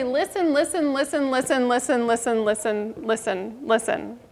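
repeated listen fading to near hush; fading repetition. Real, not acted. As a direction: let the word die away.

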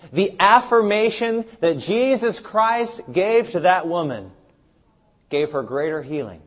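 normal talking voice

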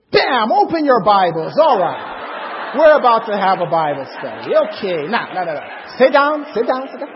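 sudden loud, forceful orders; commanding outburst. Retold or imitated. Men to girls behind a locked door, loudly commanding Bible study. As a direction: burst out like springing a surprise lesson.

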